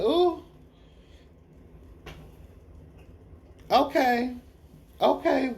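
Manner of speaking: a high squeal of being impressed; high-pitched sassy okay, properly impressed; impressed ooh. Retold or imitated. Speaker reacts to Daenerys.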